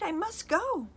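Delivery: high, loud hurried; urgent panic. A narrator's reading. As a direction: rush out in panic. Panicked exit.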